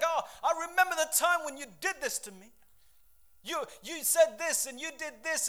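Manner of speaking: high, loud acted accusation; accusatory. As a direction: play someone dredging up an old grievance. Acts out someone recalling old offences.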